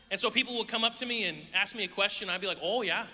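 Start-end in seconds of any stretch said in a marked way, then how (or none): 2.6-3.1 put-on Wisconsin "Oh, yeah"; Wisconsin accent. Seventh grader trying to fit in, Wisconsin accent. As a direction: round the O like a Wisconsinite. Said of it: "I'm gonna start talking like that".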